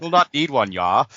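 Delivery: broad Fargo lilt, ending on "yah"; Fargo accent. Retold or imitated. Co-host re-voicing the masked guy's line, Fargo accent. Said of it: "that accent from Fargo".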